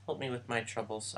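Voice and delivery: ordinary talk, nothing marked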